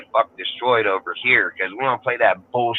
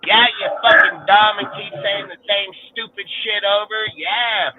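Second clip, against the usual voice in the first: annoyed rant; 7 semitones higher than usual. Real, not acted. Ranting at dumb people.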